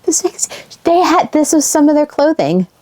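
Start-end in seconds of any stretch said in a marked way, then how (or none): 0.0-0.5 real sadness breaking in mid-sentence; so sad. Real, not acted.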